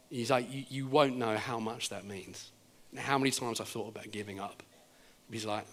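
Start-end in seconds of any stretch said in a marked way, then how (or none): 0.5-4.6 low, soft imitation of a touched pastor; understated emotion, hushed and low; moved, retold. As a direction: confide it quietly, as if humbled. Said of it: "he is starting to weep".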